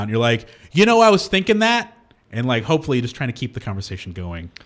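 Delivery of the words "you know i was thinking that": higher, louder bluff; faked knowing agreement. Bluffing a mechanic, pitched up and eager. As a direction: bluff like you were thinking that.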